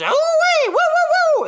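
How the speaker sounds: high, loud falsetto whooping; crowd whoops. Retold or imitated. Comedian mimics the rodeo crowd, high loud whoops.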